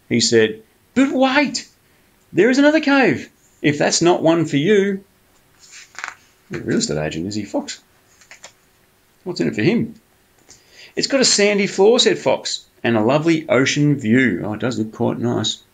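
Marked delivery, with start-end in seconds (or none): 1.0-5.0 much higher, eager fox calling after bear; pleading fox. Acted. 11.0-14.4 higher, coaxing sly fox voice. Acted. Fox tempts Bear.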